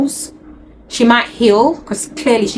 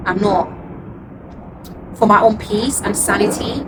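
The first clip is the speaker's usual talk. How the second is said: ordinary talk, nothing marked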